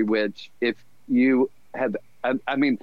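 ordinary talk

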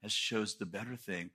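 quiet reassurance; gentle Jesus. Retold or imitated. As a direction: soothe a worried rule keeper. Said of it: "And Jesus is like".

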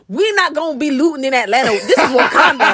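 hammed-up retelling of a rapper on the news; imitating T.I. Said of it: "T I went on the news and he was like".

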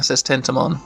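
usual speaking voice

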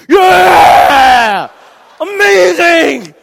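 very loud, high whooping cheer; rowdy cheering. Retold or imitated. Guys in the theater cheering the actress, loud whoops. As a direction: whoop like a stadium crowd.

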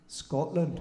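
"Scotland" in a Scottish accent. Shown as a demonstration. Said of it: "how to say Scotland in the Scottish accent".

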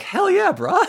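high-pitched hype-man cheer; supportive bros. Retold or imitated. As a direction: cheer him on in a high mock-bro voice. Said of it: "are so supportive after the initial shock".

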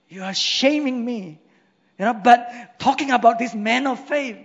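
fervent preacher voice; preaching at full force. Acted. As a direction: rouse the congregation.

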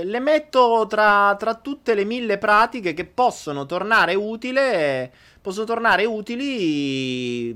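slow, high, soothing advice; calm guru voice. Real, not acted. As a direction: speak like a gentle guru.

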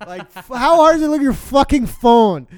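high, loud exasperated rant; angry rant. Real, not acted. Fuming to friends about a missing buddy, loud rant. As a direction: vent the frustration full force.

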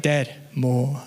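lightly imitated small boy, low; terse little plea for seconds; toddler son. Son asking dad for another piece, lightly imitated toddler. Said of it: "that's how he sounds".

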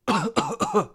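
high, repeated mock cough; imitated dry cough. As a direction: mimic a neighbour's dry hacking. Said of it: "had the dry cough of being like".

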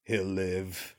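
very low, flat terminator voice; deadpan machine delivery, low; Arnold impression. Quoting the terminator to his co-host in a low monotone. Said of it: "and he goes".

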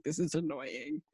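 low, slow, flat-out unimpressed; deadpan annoyance. Retold or imitated. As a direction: let the annoyance sink low and drag. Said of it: "and I was like".

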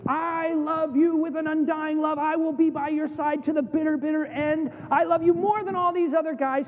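high, impassioned declaration of devotion; preacher acting out a zealous promise; fervent vow. Peter's fervent vow to Jesus, acted.

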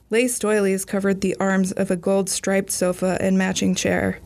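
plain reading voice

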